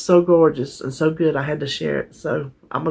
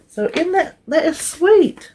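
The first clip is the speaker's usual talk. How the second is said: much higher, slow and touched; touched delight; 12 semitones higher than usual. Real, not acted. Reading a viewer's card aloud, touched and delighted. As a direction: melt over the sweet card.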